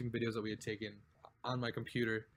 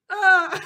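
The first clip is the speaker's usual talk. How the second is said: sudden loud high yelp, imitated; high yelp of pain; 16 dB louder than usual. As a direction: reenact a startled cry of pain. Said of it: "it was so high pitched".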